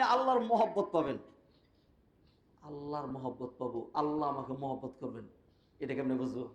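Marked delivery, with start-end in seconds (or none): 2.6-6.5 much softer and lower, half to himself; preacher voices a listener's quiet doubt; quiet musing. Retold or imitated. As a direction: drop the voice and wonder aloud. Echoes the point as a question.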